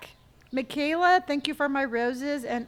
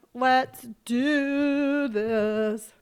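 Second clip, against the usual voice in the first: sassy; 3 dB louder, much slower than usual. Pretend. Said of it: "Sassy [name] tonight".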